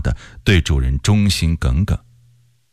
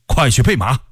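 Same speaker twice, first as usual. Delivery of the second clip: raised-pitch urgent order; agitated command; 5 semitones higher than usual. A narrator's reading. General ordering attendants before leaving at night, agitated command. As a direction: give the order higher, with a touch of agitation. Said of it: "他感情十分激动".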